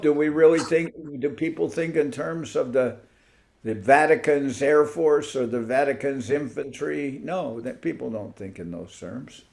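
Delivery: ordinary talk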